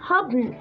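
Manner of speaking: "happen" as hab-n, no second vowel; syllabic N. Shown as a demonstration. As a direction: let N alone carry the last syllable of "happen". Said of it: "راح يلتصق بال N".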